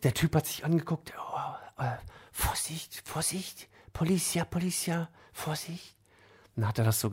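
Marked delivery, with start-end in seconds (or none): 1.2-3.7 urgent hushed whisper; whispered warning. Retold or imitated. Money changer warns father of police, tense whisper. Con man watching for police. 3.9-5.9 hushed warning. Retold or imitated. Con man watching for police.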